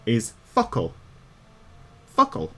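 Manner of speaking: "Fuckle" said as Irish "FUCK-ul", stress on the first part; Irish word for words. Shown as a demonstration. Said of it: "That is the literal word for words".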